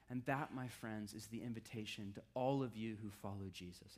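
ordinary delivery

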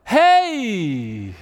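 loud, high, beaming hey with a laugh in it; bright excited greeting. Retold or imitated. Preacher imitating the cheerful Canadian coworker, loud beaming hello. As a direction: greet the whole office like you are thrilled to see them. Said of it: "She's like, head up".

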